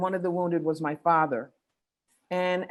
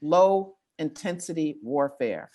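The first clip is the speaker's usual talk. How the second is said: very slow, word by word; really slow; 2 semitones lower, slower than usual. Shown as a demonstration. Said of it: "I like to say that really slowly".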